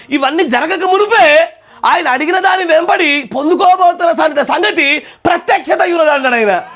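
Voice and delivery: high, loud preaching build; fiery sermon climax, high and loud; preaching at full force. Acted. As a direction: proclaim it to the congregation with rising fire.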